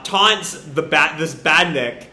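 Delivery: ordinary talk, nothing marked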